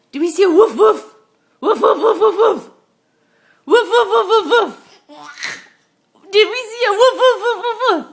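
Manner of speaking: put-on high, loud woof woof for a baby; playful dog barks. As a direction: bark like a happy puppy. Parent barking for baby.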